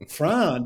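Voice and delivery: "Fran" for frown, one long 'ah' vowel; Pittsburgh pronunciation. Shown as a demonstration. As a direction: say "Fran" with no 'ow' glide. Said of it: "and we say".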